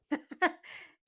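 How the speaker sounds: high giggling burst; nervous laugh. Real, not acted.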